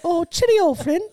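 high-pitched, badly faked English accent. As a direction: put on a terrible British greeting, way up high. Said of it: "not a very good English accent".

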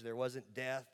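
ordinary talk, nothing marked

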